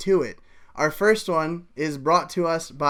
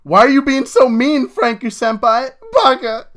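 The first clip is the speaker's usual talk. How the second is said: high, loud whiny outburst; hammed-up whine; 9 semitones higher, 10 dB louder than usual. A narrator's reading. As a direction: throw an over-the-top anime tantrum. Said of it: "Joe begins to whine".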